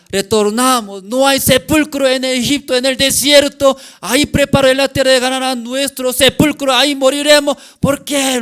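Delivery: high, loud wailing protest; acted outcry in one relentless high stream; shouted complaint. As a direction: cry out as a panicking, grumbling crowd. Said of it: "grita, murmura".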